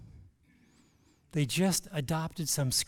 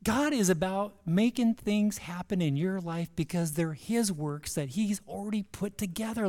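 usual speaking voice

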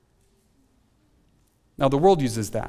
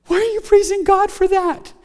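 puzzled question; 13 semitones higher, 3 dB louder than usual. Pretend. Voices a puzzled listener.

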